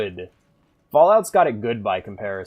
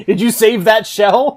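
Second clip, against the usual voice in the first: laughing; 12 semitones higher, 11 dB louder than usual. Real, not acted. Laughing at enemy saving shell.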